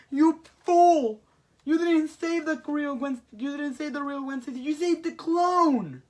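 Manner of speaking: high, loud, drawn-out berating; hammed-up outraged yelling; furious villain shout. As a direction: berate a fool who ruined everything. Villain berates rescuer in retelling.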